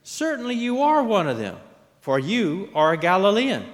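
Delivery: high, loud, insistent accusation. A narrator's reading. Bystanders accuse Peter.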